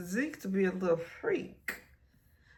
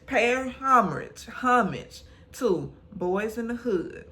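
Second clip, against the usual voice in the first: louder, slow and punched; emphatic; 4 semitones higher, 5 dB louder than usual. Real, not acted. Explaining the dream, slow punched emphasis. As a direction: lean hard into each word.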